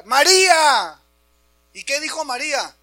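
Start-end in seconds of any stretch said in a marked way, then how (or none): 0.0-1.0 loud, high shout to make her hear; shouted call. Acted. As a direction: call out sharply over her anguish. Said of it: "le tienes que gritar para que te oiga".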